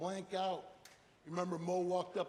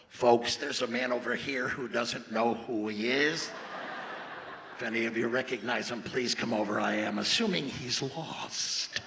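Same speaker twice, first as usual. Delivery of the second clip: loud, low, slow airport announcer; loudspeaker announcement; 5 semitones lower, 12 dB louder than usual. Retold or imitated. Airport lady on the loudspeaker, booming announcement. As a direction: boom it out to the whole terminal. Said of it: "I just got on the loudspeaker".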